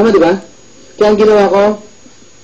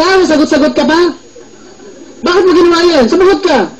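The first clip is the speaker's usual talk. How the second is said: high, scolding mom voice demanding an answer; mock-furious mother; angry mother; 8 semitones higher than usual. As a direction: snap at him like an angry mom. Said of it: "Sabi ko".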